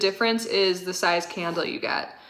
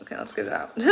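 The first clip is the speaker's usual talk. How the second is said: whisper; 8 dB quieter, much faster than usual. Real, not acted. Whispered while unboxing.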